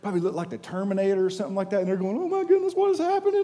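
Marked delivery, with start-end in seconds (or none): 2.2-3.4 high, frantic cry of alarm; panicked disciples. Acted. Frightened disciples in the boat see a figure: high panicked cry. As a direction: shriek it like scared sailors in a storm.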